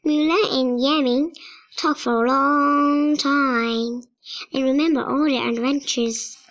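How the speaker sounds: ordinary delivery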